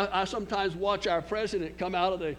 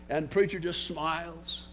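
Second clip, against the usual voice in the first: ordinary talk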